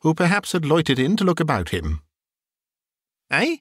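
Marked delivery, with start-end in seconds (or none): 3.3-3.6 puzzled little query; boy's wonder. A narrator's reading. Boy in Sunday clothes answers Scrooge, wondering. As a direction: ask it in sheer bafflement. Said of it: "with all his might of wonder".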